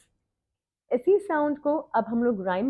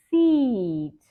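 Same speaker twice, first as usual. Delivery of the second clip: quick and short; fast. Shown as a demonstration. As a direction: say Seat briskly. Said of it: "slow and then fast".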